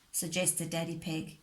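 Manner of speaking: ordinary delivery, nothing marked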